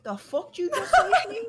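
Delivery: high, loud, laughing shock; half laughing, fully scandalized. Retold or imitated. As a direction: daughter laughing in disbelief at mom. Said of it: "I'm like".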